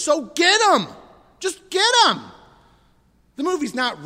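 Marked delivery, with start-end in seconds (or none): 0.0-2.2 higher and louder, egging them on; a hint of a fired-up father shouting at the screen; riled-up dad. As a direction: urge them on like a dad cheering at a movie. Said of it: "It's like my dad in that movie".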